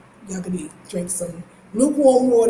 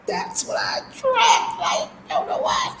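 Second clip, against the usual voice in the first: exasperated squeal; 13 semitones higher, 3 dB quieter than usual. Real, not acted. Laughing at who she attracts.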